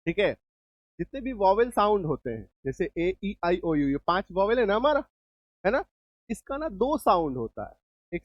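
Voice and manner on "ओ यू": usual speaking voice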